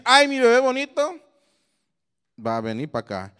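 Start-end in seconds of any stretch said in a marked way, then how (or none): 0.0-1.2 high, loud doting coo; raised cooing voice for a baby; baby talk. Shown as a demonstration. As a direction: fawn over a little baby. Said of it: "el que no escuche decir".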